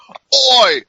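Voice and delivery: mock a brash Irish brawler; shouty, high-pitched imitation of an Irish woman's taunt; mock Irish accent. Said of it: "be like".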